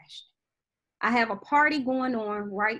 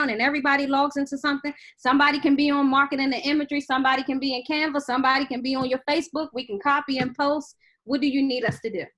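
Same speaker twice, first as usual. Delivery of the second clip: rapid rundown; 3 dB louder, faster than usual. Retold or imitated.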